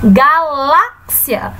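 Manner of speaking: "galáxia" drawn out slowly, weight on "lá"; stressed middle syllable. Shown as a demonstration. Teacher repeating the word for pupils, slow stressed middle syllable. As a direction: say "galáxia" slowly, leaning hard on the "lá". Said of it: "A sílaba acentuada é a sílaba lá".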